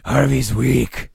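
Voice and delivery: rasping whisper swelling to a loud growl; Harvey's gruff alternate voice. Acted. Two-Face speaking of Harvey, gruff and menacing. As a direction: growl it like a menacing split personality. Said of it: "the kind of gruff alternate voice".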